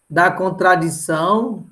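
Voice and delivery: ordinary delivery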